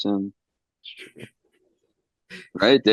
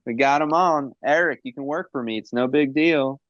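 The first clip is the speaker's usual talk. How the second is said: Conrad Thompson voice; 7 semitones higher than usual. Pretend. Hyping Conrad Thompson, mock boss.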